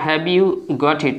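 words drawn out very slowly while writing; slow while writing it on the board; very slow dictation. Shown as a demonstration. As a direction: say it slowly as you write it down. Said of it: "হাতের লেখা বোঝা যাচ্ছে কিনা".

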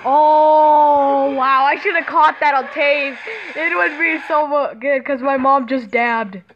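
loud and amazed; loud amazed reaction; excited. Real, not acted. Loud reaction to his mom.

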